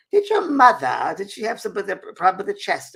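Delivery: Mickey asks about the mother's chest, lightly voiced; Mickey the spirit. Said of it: "then Mickey came in and he said".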